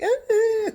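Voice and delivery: a short, loud, high squeal; high mock shriek. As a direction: mimic the women shrieking. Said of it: "They were like".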